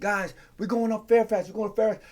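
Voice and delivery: thin mock crowd announcement, unheard; leader on a megaphone. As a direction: imitate a leader calling out weakly. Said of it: "a megaphone".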